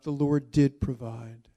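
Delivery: "did" hit loud and clear; contrast, the Lord DID provide; stress on did. Shown as a demonstration. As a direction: punch the word did, not will.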